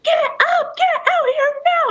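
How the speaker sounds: high urgent yell down the stairs; yelling for the drunk ex to get up; retold screaming. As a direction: scream for him to wake up and come. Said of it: "I'm screaming his name".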